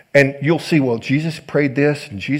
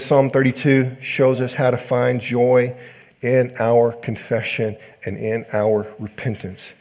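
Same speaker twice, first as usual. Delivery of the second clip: ordinary talk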